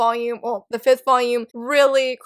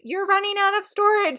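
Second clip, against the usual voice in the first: high-pitched device warning; iPad voice; 8 semitones higher than usual. Retold or imitated. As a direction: put on a high voice for a nagging gadget alert. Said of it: "my iPad was like".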